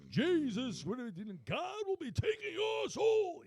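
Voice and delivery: high, loud mock preacher wail; fire and brimstone preacher. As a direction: ham up a hellfire sermon. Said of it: "He has a fire and brimstone".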